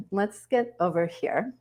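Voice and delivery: ordinary delivery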